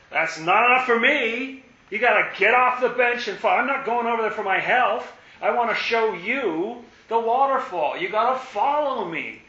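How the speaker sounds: exasperated guide demanding they follow; insistent guide. Acted. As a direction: call them off the bench with force. Guide scolding the sitters.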